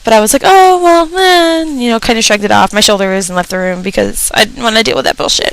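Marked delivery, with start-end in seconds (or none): 0.4-1.6 high dismissive shrug; mock shrug. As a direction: brush it off lightly.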